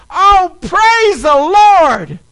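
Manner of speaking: high, loud, exultant shouting; overjoyed lady crowing with thanks; jubilant praise. Retold or imitated. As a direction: celebrate wildly as if jumping and clapping. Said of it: "jumping up and down and clapping her hands".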